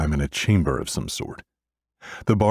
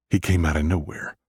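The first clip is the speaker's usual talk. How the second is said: ordinary delivery, nothing marked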